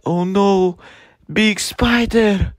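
normal talking voice

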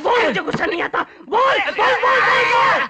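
furious shouting. Acted. Enraged man screams at the waiter to admit he isn't angry. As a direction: scream it into his face.

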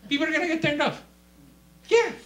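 high, loud monotone yell; mock zealous shout. Preacher parodying fleshly witnessing, high shout. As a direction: blast it out, high and held.

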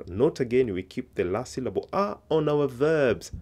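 ordinary talk, nothing marked

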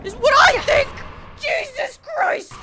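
high, ragged yelling rant; screaming in frustration; furious rant. Real, not acted. Raging at enemies in game.

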